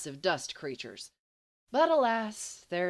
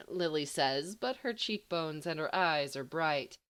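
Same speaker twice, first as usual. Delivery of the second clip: ordinary delivery, nothing marked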